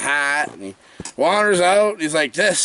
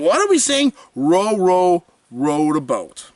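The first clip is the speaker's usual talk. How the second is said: imitating mom. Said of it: "And the mom's like".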